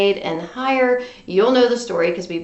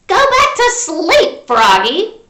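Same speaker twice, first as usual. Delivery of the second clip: higher, louder, slow firm mother; mother's voice; 7 semitones higher, 8 dB louder, slower than usual. A narrator's reading. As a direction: tell the frog child to sleep. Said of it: "said his mother".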